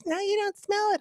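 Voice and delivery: high pleased mock wife; high imitation of wife. Wife spraying scent.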